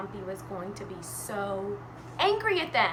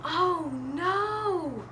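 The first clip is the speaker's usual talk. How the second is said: higher, drawn-out lament; mock dismay; 5 semitones higher than usual. A narrator's reading. Storyteller reacts to the boat flipping, mock dismay. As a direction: stretch out a playful cry of dismay.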